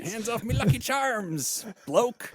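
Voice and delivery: slow, high, hammed-up Irish accent with Aussie slang; muddled Irish-Australian accent. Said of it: "it's all the same".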